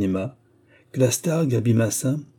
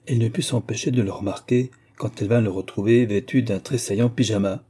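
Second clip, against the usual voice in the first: usual speaking voice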